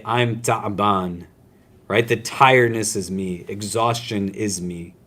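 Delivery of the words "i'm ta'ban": I'm ta'ban dragged out slowly; slow Arabic ta'ban. Shown as a demonstration. Teacher acting exhausted in class, slow drawn ta'ban. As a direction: drawl ta'ban like someone worn out. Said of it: "I'd say".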